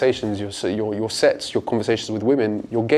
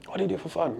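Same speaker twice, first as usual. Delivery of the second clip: much softer, intimate repeat of the question; 6 semitones higher, 10 dB quieter, faster than usual. Shown as a demonstration. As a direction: ask it again, quieter and closer. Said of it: "Typically I like to say".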